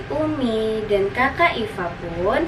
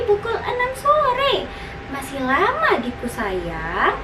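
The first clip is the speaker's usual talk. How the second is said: high, teasing sing-song voice; laugh, then tease little brother in high tones; amused big sister; 6 semitones higher than usual. Acted. Sister tells her hungry brother it's still morning, high amused voice. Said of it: "sahut kakak Iva".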